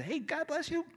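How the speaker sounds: high, light, softened greeting; friendly greeting re-enacted. Preacher greeting people at the gym, light and friendly. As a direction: toss out a high, quiet blessing. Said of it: "I can fist bump and say".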